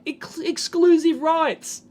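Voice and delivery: high, slow, disbelieving repeat; incredulous scoffing. Real, not acted. Vlogger repeating a company's dubious claim, incredulous. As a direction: repeat it slowly as if absurd.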